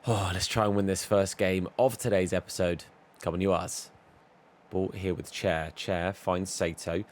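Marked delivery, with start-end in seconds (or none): none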